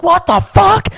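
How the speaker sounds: high, loud disbelieving yell; retold shout. Storyteller seeing his puppy in the lake, alarmed yell.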